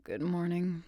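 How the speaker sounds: sleepy, groggy greeting; just woke up, groggy. Acted. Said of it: "with a groggy voice".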